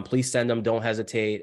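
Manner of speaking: usual speaking voice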